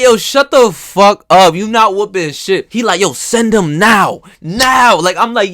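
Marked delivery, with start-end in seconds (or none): 0.0-2.7 loud, high, slow mock shouting at his friend; loud retold yelling back at his boastful friend; heated retold yell. Retold heated trash talk. 3.0-5.0 high, loud, slow insistent shout; loud imitated dare to send Mike Tyson; mock demanding yell. Friend demanding Tyson be sent.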